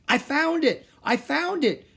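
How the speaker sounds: very high, loud exclaiming; excited cry. A narrator's reading. Boy finding the treasure chest, excited shouting. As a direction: cry out the thrilling discovery. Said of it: "cried Jack".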